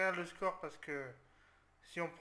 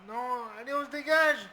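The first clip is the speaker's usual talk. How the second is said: shouting his players on, louder and higher; cri d'encouragement; 6 semitones higher, 10 dB louder than usual. Real, not acted. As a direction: urge them to clear it, rising. Match tendu, il encourage ses joueurs. (crie pour encourager, plus fort et plus aigu)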